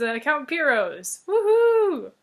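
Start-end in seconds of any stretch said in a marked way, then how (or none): 1.3-2.1 high, loud whoop; cheer. Real, not acted. Storyteller cheering the palace won, excited. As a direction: celebrate the win with a whoop.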